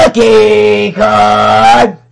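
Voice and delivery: big shout. Real, not acted. Announcing each card to the camera, shouting loud. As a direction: bellow it like a game show.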